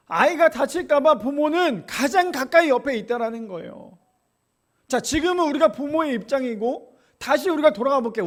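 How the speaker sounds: loud, high fervent preaching; preaching at full force. Acted. Pastor stirring the congregation, loud preaching. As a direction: press the point home passionately.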